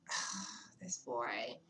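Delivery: low, slow, muttered "ugh"; exasperated groan. Retold or imitated. Fed up with her date, a low, slow groan. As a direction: sigh it out in disgust.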